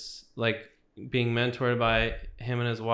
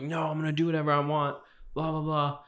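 higher, fast, mock-defiant teen snarl; defiant anger; 5 semitones higher, faster than usual. Retelling defying his parents, fast mock-defiant snap. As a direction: throw back the parents' rules, fast and cocky. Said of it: "this deep, like, anger".

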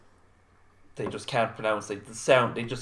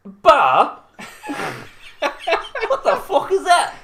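loud laughing disbelief; baffled shout; 10 dB louder than usual. Retold or imitated. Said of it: "they were like".

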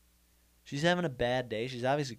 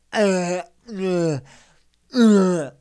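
loud straining grunts; man straining at the gym, loud grunting; strained grunts; 6 semitones higher, 13 dB louder, much slower than usual. Retold or imitated. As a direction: grunt hard as if pushing through a heavy rep. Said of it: "I made those fa".

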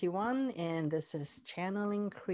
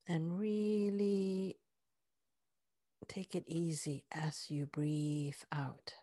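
guided meditation; 2 semitones lower, 3 dB quieter than usual. Acted. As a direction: ease them inward with soft breathy words. Heart-focus guided meditation.

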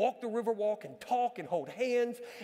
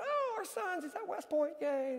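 mock cheerful reply; 9 semitones higher, 3 dB quieter than usual. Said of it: "I said".

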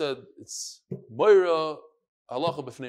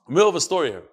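ordinary delivery

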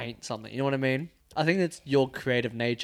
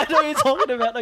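high, loud, quick and full of laughter; laughing hard; 13 semitones higher, 6 dB louder, faster than usual. Real, not acted. Friend recognises the shared disgusting story, laughing hard. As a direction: squeal it out through laughter. Both crack up over shared memory.

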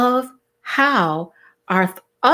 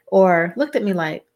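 ordinary delivery

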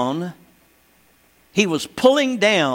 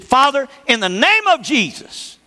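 loud prayer; 7 semitones higher than usual. Retold or imitated. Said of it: "she's louder than the hail".

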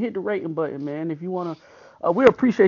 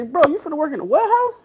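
incredulous; 9 semitones higher, 3 dB louder, faster than usual. Retold or imitated. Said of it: "I say".

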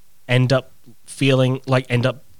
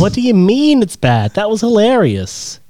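higher, slow indignant insistence; 9 semitones higher, 3 dB louder, slower than usual. Real, not acted. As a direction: drag it out, outraged. Defending own joke.

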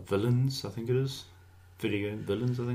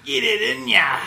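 Aussie ad-lib; 7 semitones higher, 4 dB louder than usual. Pretend. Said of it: "Australian ad-libs?".

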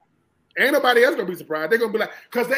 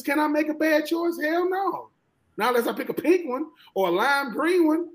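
normal talking voice

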